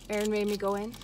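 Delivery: normal talking voice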